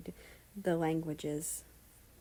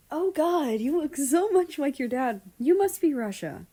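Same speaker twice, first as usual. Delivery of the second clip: loud, high, delighted; excited greeting; 9 semitones higher, 7 dB louder than usual. Acted. Dutch gushes over Russia, loud and excited. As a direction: gush over the boy.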